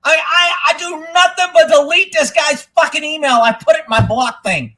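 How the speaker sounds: loud, high exasperated rant; real fed-up outburst; upset rant. Real, not acted. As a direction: vent at the top of your range. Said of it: "I don't know why I'm getting so upset".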